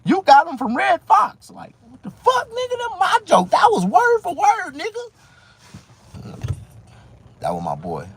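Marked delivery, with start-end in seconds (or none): 0.0-1.5 high, loud mocking voice of the joke thief; shrill put-on rival voice; mocking Ezal. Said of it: "told me, said". 1.5-6.4 high, loud outraged shout; furious high disbelief; outraged yell. Retold or imitated. Outraged at stolen jokes.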